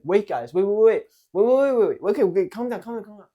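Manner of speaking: loud, high, repeated interruption; attention-grabbing call. Shown as a demonstration. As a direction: cut in over the group, high and loud. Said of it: "I get everybody's attention".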